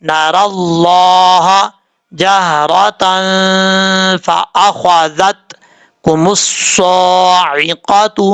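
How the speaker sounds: drawn-out syllable by syllable recitation; slow practice reading. Shown as a demonstration. Teacher leads slow practice reading of the verse. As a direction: read slowly so students can follow. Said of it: "میرے ساتھ ساتھ پڑھنے کی کوشش".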